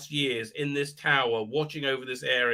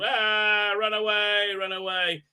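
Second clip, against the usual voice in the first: higher, hammed-up cry to run; mock panicked cry; 6 semitones higher, slower than usual. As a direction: yell at them to flee, half-joking. Fleeing from attacking orc.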